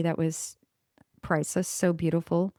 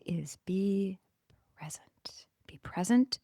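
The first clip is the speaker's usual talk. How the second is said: soft whisper, much softer; 6 dB quieter than usual. Real, not acted. Urging listeners to be present, in a whisper. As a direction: hush it like a secret.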